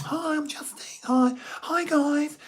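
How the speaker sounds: very high falsetto lisp, slowed; put-on squeaky-high Justine greeting; another octave up. Shown as a demonstration. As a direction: lisp it an octave above your own voice. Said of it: "another octave".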